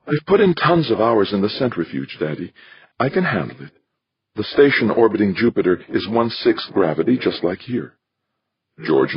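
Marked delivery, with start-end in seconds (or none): 0.1-7.9 put-on higher young woman's voice, earnest; daughter pleading. Daughter pleads with father to let her go, earnest.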